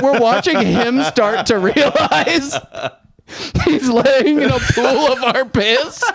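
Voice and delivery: can barely hold back laughter; laughing while telling. Real, not acted. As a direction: tell it through real giggles. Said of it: "I'm trying not to laugh".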